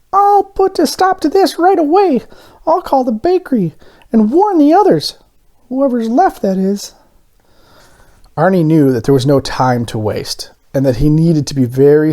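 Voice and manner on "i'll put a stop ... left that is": very high, resolute little voice; high determined donut. A narrator's reading. Arnie plans to warn the bakery, high and determined. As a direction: plot it firmly. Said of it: "He thought to himself".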